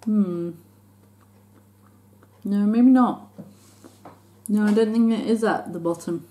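speaking between bites; chewing while talking. Real, not acted. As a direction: mouth partly full of food. Seen 2: Bites piece at -4, closed-lip chewing through early labelled words.

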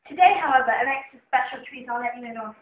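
usual speaking voice

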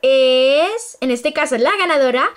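slow, drawn-out suspense reveal; hyped giveaway announcement. Real, not acted. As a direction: stretch it out before the name. Announcing the last winner. (anuncio lento y emocionado)

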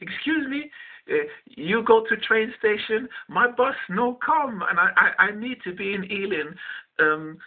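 put-on high-pitched broken-English East European accent, slow in places. Woman asking a stranger for a lift, East European accent. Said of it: "in an East European accent".

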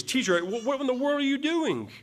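frightened disciples berating a sleeping Jesus; exasperated rebuke. Acted. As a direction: scold the sleeping teacher in alarm. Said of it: "the rebuke in their voice".

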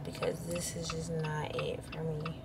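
weary whisper, barely any voice. Real, not acted. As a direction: breathe it out as a tired whisper. Drained, winding down her rant.

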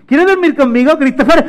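high, loud pet-coaxing voice; eager invitation. Acted. Mateo inviting his dog to bed, high and eager. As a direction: ask the dog excitedly.

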